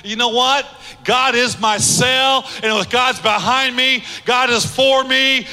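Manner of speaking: full-force preaching. Real, not acted. Preacher rallying the congregation that God is faithful, forceful and high. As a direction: hammer out each declaration with building conviction.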